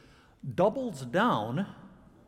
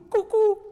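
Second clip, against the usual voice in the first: cuckoo sung out much higher and louder like the bird; imitated cuckoo call; 8 dB louder than usual. Shown as a demonstration. Preacher showing onomatopoeia with a mock cuckoo call. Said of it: "a word sounds like what it names".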